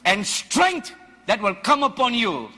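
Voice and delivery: full-force preaching. Acted. Preacher rousing the congregation about a wild ox, shouting. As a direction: thunder it at the congregation.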